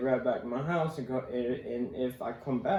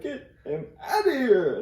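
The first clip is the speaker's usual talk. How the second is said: mock yell; 11 semitones higher, 7 dB louder than usual. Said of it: "And she was like".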